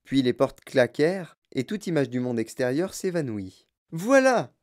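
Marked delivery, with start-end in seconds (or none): 3.9-4.5 high, loud exclamation; ringing high-pitched cry; Wonka crying out. A narrator's reading. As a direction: call it out triumphantly to the group. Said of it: "s'écria monsieur Willy Wonka".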